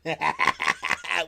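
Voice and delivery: high, loud burst of laughter. Real, not acted. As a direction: explode into laughter. Cracking up at the scene.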